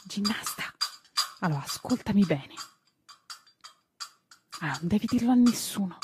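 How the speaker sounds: hushed child's secret, lightly acted; whispering. A narrator's reading. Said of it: "gli sussurrò una mattina Tistù".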